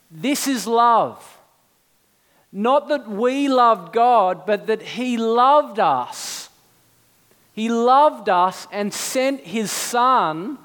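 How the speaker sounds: slow, solemn scripture reading; quoting the Apostle John slowly and solemnly. Retold or imitated. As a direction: weigh each word of the verse. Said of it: "this is what the Apostle John says".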